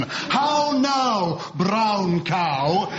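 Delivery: plummy received pronunciation demo; mock plummy elocution; posh RP. Shown as a demonstration. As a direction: round every vowel like an old announcer. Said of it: "is a perfect example of enunciating with received pronunciation".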